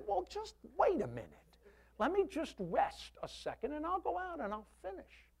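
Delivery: hammed-up stubborn man refusing help; mock stubborn husband. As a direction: play the proud husband who won't quit. Stubborn husband quoted to wife.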